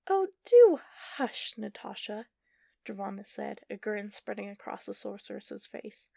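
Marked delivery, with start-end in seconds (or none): 0.1-2.3 much higher, much louder, slow scornful hush; mocking hush. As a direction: wave her rival off in high theatrical scorn. Sorceress silences rival.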